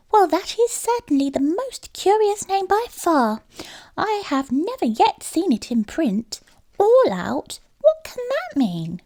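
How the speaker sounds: a mouse who has never heard such a name; puzzled little mouse. A narrator's reading.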